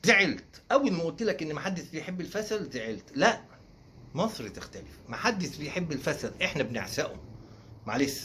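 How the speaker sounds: usual speaking voice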